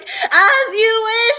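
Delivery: a high, slow, hammed-up yell of the line; shouted Wesley line. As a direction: fling it out as he falls, mock dramatic. Wesley falling, acted quote.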